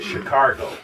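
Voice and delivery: Cincinnati-style Chicago with an added r, "Shi-CAR-go"; Cincinnati pronunciation. Retold or imitated. Recalling Cincinnati people, Cincinnati pronunciation with an r. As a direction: put a Cincinnati r into the middle of Chicago. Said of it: "they said Chicago".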